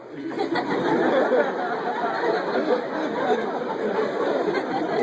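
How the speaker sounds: long unvoiced wheezing laugh; real helpless laughter; breathy laugh. Real, not acted. Laughing at own clumsiness.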